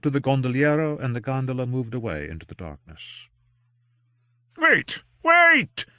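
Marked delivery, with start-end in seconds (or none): 4.6-5.9 high, loud calling after him; cried out. A narrator's reading. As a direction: call after the vanishing boat. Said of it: "cried Doctor Greysteele".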